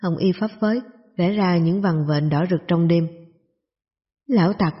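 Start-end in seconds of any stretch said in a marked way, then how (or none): none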